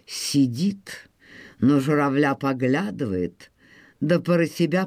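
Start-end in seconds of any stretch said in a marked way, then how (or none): none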